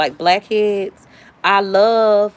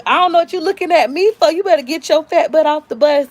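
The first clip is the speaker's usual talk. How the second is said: bossy big sister; 7 semitones higher than usual. Retold or imitated. Sister snaps at her on bus.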